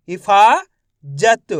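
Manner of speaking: हिफाजत in two parts, हिफा rising, then जत; sounded out. Shown as a demonstration. Teacher shows pupils the stretched sound, sounded out. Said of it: "फा का उच्चारण यहाँ पे खींच को रहा है".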